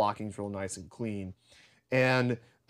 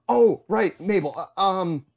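flustered student; 6 semitones higher, 5 dB louder than usual. Retold or imitated. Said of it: "And I go".

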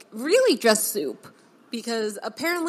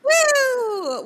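excited cheer; 7 dB louder than usual. Real, not acted. Podcast intro cheer.

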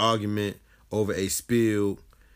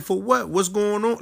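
high, indignant retort; retelling his own upset to the airport agents; exasperated demand; 9 semitones higher than usual. Retold or imitated. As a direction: throw it back at them, rattled and demanding.

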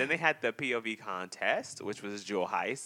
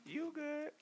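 teasing; 8 dB quieter than usual. Real, not acted. Teasing reply.

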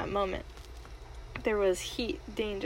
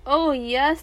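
a bright, eager "oh yes"; 5 semitones higher, 8 dB louder than usual. Acted. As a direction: gush with delight. Queen eagerly agrees.

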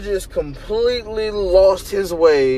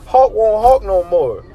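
ordinary talk, nothing marked

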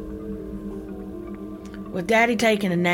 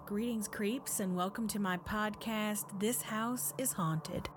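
spooky host voice; 3 semitones higher, 15 dB quieter than usual. Acted. Spooky podcast host intro.

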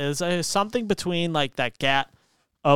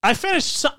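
defensive; 10 semitones higher, 4 dB louder than usual. Real, not acted. Defending himself to Tony.

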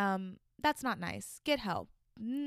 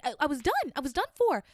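higher and louder, heated; excited; 6 semitones higher, 6 dB louder than usual. Real, not acted. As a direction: relive the panic with energy. Retelling the desperate moment.

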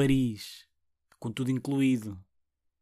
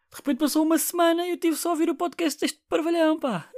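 mock complaining listener; 14 semitones higher than usual.

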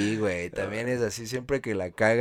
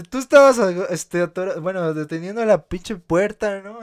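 mock loud reproach; 7 semitones higher, 8 dB louder than usual.

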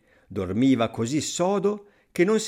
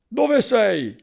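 loud, high "where are you"; searching call; 10 semitones higher, 7 dB louder than usual. A narrator's reading. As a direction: shout out to someone unseen. Thief calls to unseen voice.

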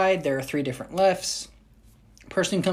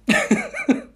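high laugh; 4 dB louder than usual. Real, not acted. Laughing at own bit.

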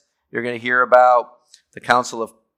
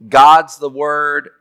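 "God's the Word" slow and loud; emphatic stress; 6 dB louder, much slower than usual. Shown as a demonstration. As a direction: hammer each word. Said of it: "much more emphatic".

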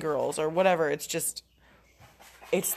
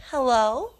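high, louder, sugary-sweet hello; cutest, sweetest voice; 5 semitones higher, 6 dB louder than usual. Retold or imitated. Employee answering her boss's call in her cutest, sweetest voice. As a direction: lay on the cute, sweet voice, pitched up. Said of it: "in my cutest, sweetest voice".

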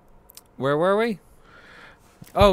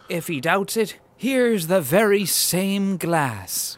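much higher, drawn-out declaiming; quoted movie line; 9 semitones higher, much slower than usual. Acted. Host quoting the film's flimsy proof, high drawn-out declaiming. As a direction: present it grandly, high and slow.

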